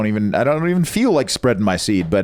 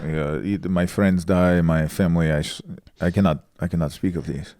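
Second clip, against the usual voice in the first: mock traumatized Armenian; 4 semitones lower than usual. Posing as war survivor to dodge questions, haltingly, Armenian accent. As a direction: falter through a fake war sob story. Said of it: "just pretend you saw some shit in some war".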